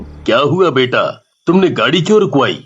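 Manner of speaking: put-on a calm dad asking his girl; father. Father asking his daughter why she stopped the car, low.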